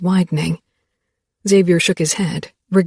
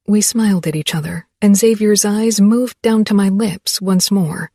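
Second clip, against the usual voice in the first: usual speaking voice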